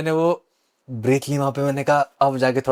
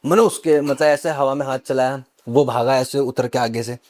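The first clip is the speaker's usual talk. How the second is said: ordinary talk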